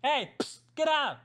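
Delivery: high sharp shout, with a psst; yelling at cats. Real, not acted.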